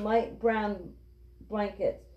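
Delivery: plain reading voice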